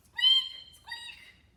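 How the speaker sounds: very high piercing squeaks; mouse squeak. A narrator's reading. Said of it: "of course, said".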